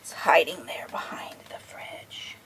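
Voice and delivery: put-on soft secret whisper to the child. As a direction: breathe it like a secret.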